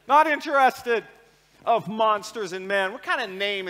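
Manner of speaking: high, loud escalating rant; mock rant. Real, not acted. Father ranting over Justin, rising to a shout. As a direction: build to a yelled shut up. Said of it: "ranting about Justin".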